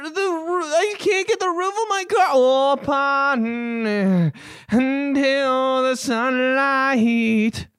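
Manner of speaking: high, slow strained rock croon; rock singer voice. Pretend. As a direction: whine it like a grunge frontman. Singer voice bit about car.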